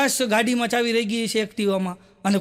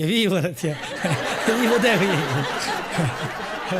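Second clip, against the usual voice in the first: laughing; 4 semitones lower, much slower than usual. Real, not acted. Laughing at own punchline.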